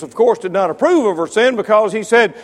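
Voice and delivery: ordinary talk, nothing marked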